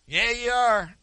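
high, loud stubborn shout; angry insistence. Retold or imitated. Angry man by the road insisting the pastor will fight, shouting. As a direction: snap it back, daring him.

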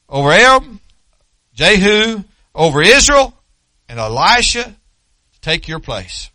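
loud, high, proclaiming voice; preaching at full force. Acted. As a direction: proclaim God's orders with fire. Preacher exhorts with God's orders.

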